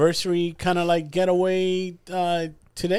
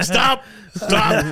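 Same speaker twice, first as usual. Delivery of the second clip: higher, laughing put-on protest; laughing mock protest; 5 semitones higher than usual. Friend imitating Danny having his ear played with, laughing protest. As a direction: squirm away, half laughing. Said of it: "He's like".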